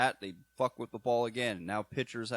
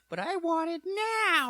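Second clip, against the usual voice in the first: high, loud, drawn-out mock tantrum; slow hammed-up brat wail; demanding child character; 6 dB louder, slower than usual. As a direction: imitate a spoilt child demanding it. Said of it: "the one that was like".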